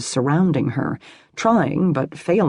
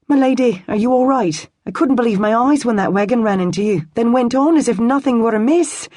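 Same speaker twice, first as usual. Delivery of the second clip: put-on anxious young servant, higher voice; worried young man; 8 semitones higher, 4 dB louder, faster than usual. A narrator's reading. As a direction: tumble out his worry quickly.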